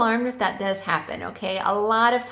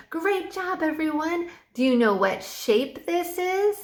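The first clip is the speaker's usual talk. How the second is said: put-on much higher, bubbly praise for little kids; enthusiastic praise; 6 semitones higher, slower than usual. Shown as a demonstration. Online teacher praising young students, excited high praise. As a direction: gush over their answer like a cheerleader. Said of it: "you have to be very enthusiastic".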